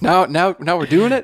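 high mocking voice. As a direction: pitch it up, mocking her turnaround. Mocking ex-wife's turnaround.

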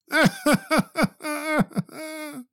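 high, soft staccato boo-hoo sobs; mock crying. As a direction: fake a wailing sob in short bursts. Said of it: "The guy just starts crying".

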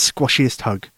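ordinary delivery, nothing marked

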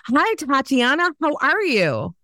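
high, sing-song sales greeting; chirpy cold-caller. Acted. Cold caller greets Tatiana on the phone, chirpy.

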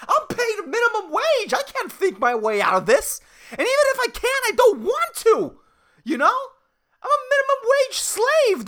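high, whiny comic indignation; exasperated rant. Acted. Minimum wage barista begs customer to leave him alone, exasperated. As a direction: protest hotly with pitch shooting up.

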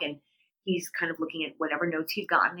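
ordinary talk, nothing marked

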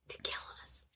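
whispered aside. Real, not acted. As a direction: hush it so nobody hears. Secret aside to party.

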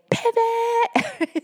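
very high, bright command of pivot; the universe commanding. Retold or imitated. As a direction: sing out the universe's order. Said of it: "universe was just like".

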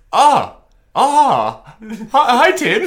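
put-on high, loud, baffled accented hello; fake-polite greeting to a stranger; Idrissa Gueye accent. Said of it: "my accent for Idrissa Gueye".

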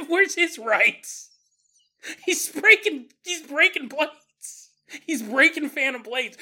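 high, drawn-out comic protest; hammed-up high-pitched advocacy; mock indignant plea. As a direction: plead the case with pretend outrage. Imagined sympathiser pleads for ghost.

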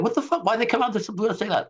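high, fast, incredulous what-the-hell; baffled outburst. Retold or imitated. Everyone reacting to Pfizer's surprise statement, high and fast in disbelief. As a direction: blurt it out in disbelief. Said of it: "everyone was wondering".

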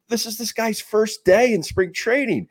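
high, drawn-out disbelief; incredulous. Retold or imitated. Teammate amazed by the new guy's first day, incredulous. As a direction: act out amazement, slow and high-pitched. Incredulous reaction to wild batting practice.

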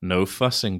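normal talking voice

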